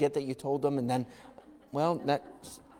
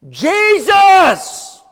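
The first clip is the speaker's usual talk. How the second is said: high, very loud drawn-out cry; screaming out; 14 dB louder than usual. Acted. Blind Bartimaeus calling to Jesus, screaming. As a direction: bellow the name at full voice, stretched. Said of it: "this guy starts screaming".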